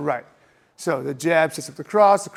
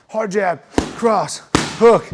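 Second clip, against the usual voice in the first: hard punches called out; 2 semitones higher, 6 dB louder, much slower than usual. Shown as a demonstration. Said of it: "throw a hundred percent on all of them".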